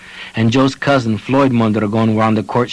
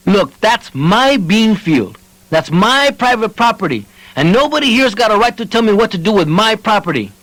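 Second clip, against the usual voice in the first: defiant; 10 semitones higher than usual. A narrator's reading. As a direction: insist hotly. Joe defends his field.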